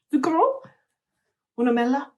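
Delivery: "Zucchero" said high, with Italian vowels; "Una mela" in a show-off Italian accent; Italian words. Shown as a demonstration. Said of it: "I'll know how to say sugar and apple".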